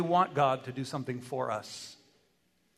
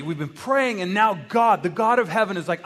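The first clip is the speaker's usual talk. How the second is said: usual speaking voice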